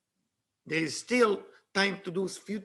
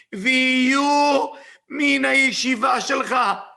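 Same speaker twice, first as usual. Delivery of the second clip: Messiah's proclamation; 4 semitones higher, 7 dB louder than usual. Retold or imitated. Messiah decreeing a place in the yeshiva, loud proclamation. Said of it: "Look what he say".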